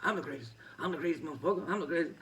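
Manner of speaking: light mock boast, pitch climbing a little with each line; Ali impression. A fighter mimics Ali to his face, lightly voiced boast. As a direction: tease him with his own famous brag. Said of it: "doing an impression right in his face".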